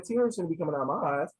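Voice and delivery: ordinary talk, nothing marked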